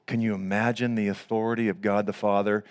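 normal talking voice

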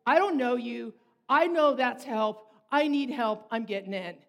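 loud desperate dog voice, slowed; earnest plea from a worn-out dog; the old male dog insisting on getting in the truck, loud and pleading; voicing an exhausted dog. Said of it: "he's like".

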